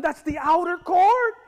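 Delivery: slow soaring preacher cry; climactic shout. Acted. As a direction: cry it out to the back row.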